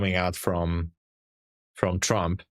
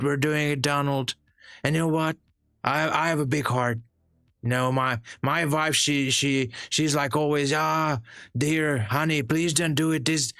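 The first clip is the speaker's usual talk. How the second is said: higher, boastful Trump voice rambling about beautiful boats; hammed-up Trump bragging, pitched up; streamer's comedy sketch as Trump, higher and boastful; Trump impersonation; 4 semitones higher than usual. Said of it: "I did a great Trump, Trump impersonation".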